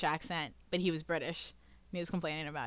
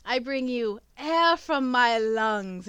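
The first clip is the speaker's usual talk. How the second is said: slow, grand, a touch louder line; imitating the Doctor; 4 semitones higher, 6 dB louder, much slower than usual. Friend retelling the Doctor's line to a tree alien, slow and grand. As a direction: drag it out like a solemn gift. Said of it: "he looks at her and he goes".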